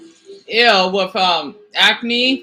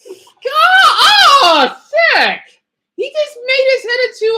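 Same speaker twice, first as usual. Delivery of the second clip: loud thrilled yell; excited shout; 12 semitones higher, 6 dB louder than usual. Real, not acted. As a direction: shout it in delight. Reacting to gore.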